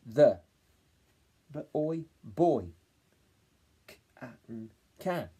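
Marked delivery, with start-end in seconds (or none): 3.9-5.3 segment then blend can; spelling out. Shown as a demonstration. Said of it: "let's read it back".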